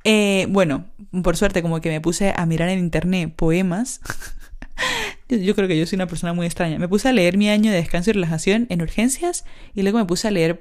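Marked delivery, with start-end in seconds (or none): 4.1-5.2 quick airy laugh under the breath; soft laugh. Real, not acted. Laughing at herself while telling listeners her habits. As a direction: let a little embarrassed laugh slip out.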